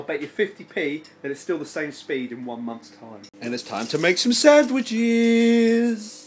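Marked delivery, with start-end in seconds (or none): 3.4-6.3 sing-song announcer voice. Pretend.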